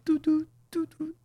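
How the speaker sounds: high-pitched electronic "doo" notes; imitated speaker chime. Explaining the speaker just makes sounds, imitated chime. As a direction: mimic a device's start-up tones. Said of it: "It just makes sound".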